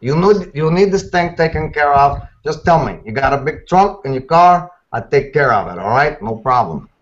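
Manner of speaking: lightly voiced tough guy offering a favor; a hint of a streetwise fixer, pushy and reassuring; Sergeant Mayfield voice. Voice actor doing a requested character for the hosts, a light tough-guy fixer. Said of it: "Sergeant Mayfield".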